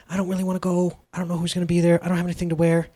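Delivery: understated acted reluctance; anxious inner voice. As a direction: fret quietly to yourself. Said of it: "That's my anxiety talking".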